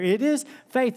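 "is" lifted high and hit hard; stress on is. Real, not acted. Preacher insisting to the congregation that "is" is the point. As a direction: lean on "is" in "It is faith".

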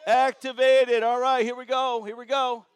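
loud call to the whole room; yelling across the room. Real, not acted. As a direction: shout it out to the crowd. Crowd photo chant.

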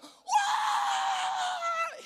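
long, very high, full-force panic scream; freaked-out scream. Acted. Servant seeing the enemy army, panicked scream. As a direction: shriek in terror, held loud and long. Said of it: "He's freaking out".

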